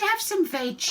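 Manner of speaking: high duckbill offer; friendly hoot. A narrator's reading. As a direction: hoot it as a cheery invitation. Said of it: "And they hooted".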